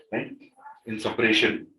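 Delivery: ordinary talk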